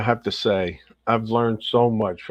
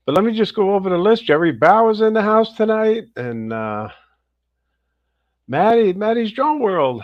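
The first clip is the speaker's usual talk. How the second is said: upbeat, higher host voice calling out names; energetic live-show host welcome; livestream shout-outs; 7 semitones higher, 4 dB louder than usual. Acted. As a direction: hype up each viewer as you greet them. Host reading viewer list.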